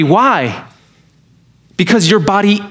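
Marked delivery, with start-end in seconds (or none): none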